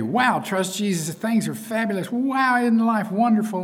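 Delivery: high, gushing mock excitement; wowed new believer. New believer marveling that life is wonderful, excited and high. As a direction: play a delighted new convert, pitched well up.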